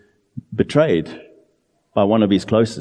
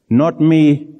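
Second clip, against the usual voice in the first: higher, louder mock denial; mock-defensive protest, pitched up; 6 semitones higher, 5 dB louder than usual. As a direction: imitate a household member quickly denying blame. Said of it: "there's a defensiveness".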